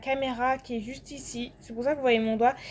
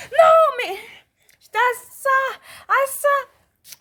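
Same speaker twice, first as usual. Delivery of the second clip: laughing outburst; 11 semitones higher, 7 dB louder than usual. Real, not acted. Laughing outburst at her near-miss.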